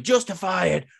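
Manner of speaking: loud, hammed-up demanding yell; mock angry shout. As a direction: bark it like an angry champion. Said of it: "He starts going".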